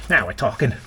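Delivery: usual speaking voice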